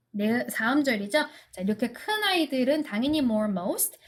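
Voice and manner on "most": usual speaking voice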